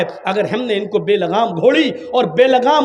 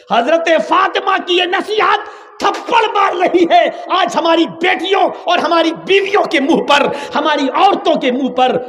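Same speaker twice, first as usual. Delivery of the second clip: fiery preaching shout; 6 semitones higher than usual. Real, not acted. Preacher rebukes society.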